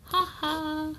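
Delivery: trickster tale voices. Acted. Raven turns into bird, laughs.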